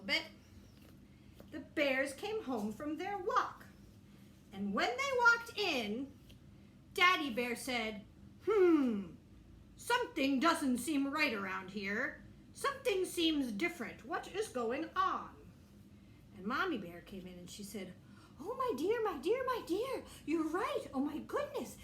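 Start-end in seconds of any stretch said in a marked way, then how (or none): 1.5-8.0 story narration. A narrator's reading. Teacher reads story to children. 8.5-15.3 Daddy Bear. Acted. Daddy Bear voiced in story. 18.4-21.8 high, fussing mother bear voice; a flustered mama bear, pitched well up; Mommy Bear. Acted.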